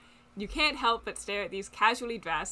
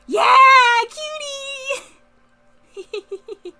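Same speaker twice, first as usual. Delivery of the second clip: excited shout; 14 dB louder, slower than usual. Real, not acted. Cheering at cute character art.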